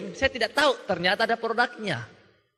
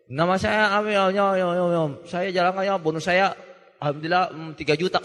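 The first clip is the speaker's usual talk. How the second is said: words dissolving into mushy babble; mock mumbler introducing himself; imitated gargled mumble; 4 semitones lower than usual. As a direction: mumble as if gargling, barely intelligible. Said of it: "ya kumur-kumur ya, tapi kedengaran juga ya".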